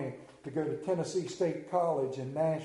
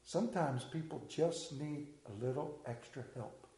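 put-on much softer sweet little girl plea; a daughter winning her father over, softly; 4 semitones lower, 10 dB quieter than usual. Preacher voicing a little girl softly thanking her daddy. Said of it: "with the big round eyes and goes".

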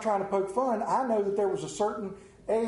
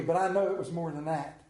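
normal talking voice

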